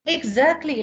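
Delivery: normal talking voice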